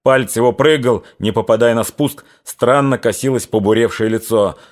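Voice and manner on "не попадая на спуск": usual speaking voice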